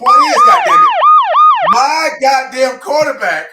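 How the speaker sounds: high, loud, slow yell. Real, not acted. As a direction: belt it out in triumph. Ranting about his quarterback.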